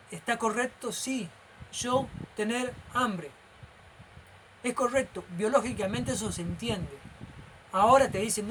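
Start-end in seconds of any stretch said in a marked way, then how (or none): 1.7-3.3 slow, each word set apart; imitated pidgin speech. Teacher defending simple grammar as understandable, imitated pidgin speech. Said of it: "se burlan mucho de los indios".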